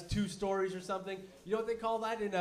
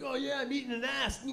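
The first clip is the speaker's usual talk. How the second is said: smug joke; 6 semitones higher than usual. Retold or imitated. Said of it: "It's like".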